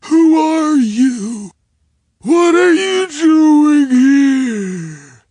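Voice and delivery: high, slow, loud cry; frantic shout. A narrator's reading. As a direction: wail it out in a rage. Attacker screaming at narrator.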